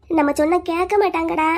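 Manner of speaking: ordinary delivery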